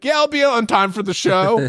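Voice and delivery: high, breezy overconfident voice; mock your own cluelessness, airy and high; mocking his past self as breezily sure; mocking naive self.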